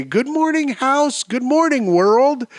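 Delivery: high, chirpy good-morning call; bright, happy morning greeting. Shown as a demonstration. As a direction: greet the day bright and bushy-tailed.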